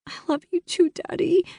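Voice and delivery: put-on high, tearful girl's voice; tearful whisper. A narrator's reading. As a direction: hold back tears as you say it. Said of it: "I whispered".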